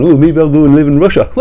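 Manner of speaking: sneering send-up, fast and laughing; mocking Morgoth. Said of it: "This is where Morgoth's gone".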